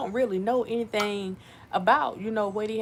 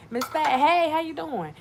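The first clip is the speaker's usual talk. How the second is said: cheery greeting; 10 semitones higher, 5 dB louder than usual. Acted.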